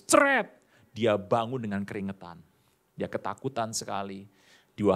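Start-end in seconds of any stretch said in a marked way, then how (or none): 0.0-0.6 high, loud startle sound; a sudden comic wake-up noise; mock jolt noise. Preacher mimics the dreamer jolting awake: high startle sound.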